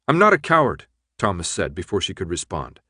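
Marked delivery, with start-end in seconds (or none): 0.1-0.9 higher, louder quick retort; stung, raised-voice denial; indignant protest. A narrator's reading. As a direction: jump in to defend yourself. Insulted hero protests before she responds.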